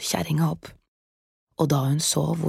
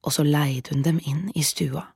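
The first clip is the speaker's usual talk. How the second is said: usual speaking voice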